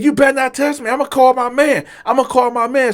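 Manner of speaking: imitated waiter, high-pitched threat; indignant warning. Waiter warning the advancing customer off, indignant warning.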